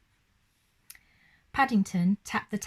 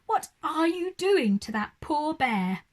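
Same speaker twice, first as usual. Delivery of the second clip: dismayed cry; 6 semitones higher than usual. A narrator's reading. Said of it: "cried Missus Brown".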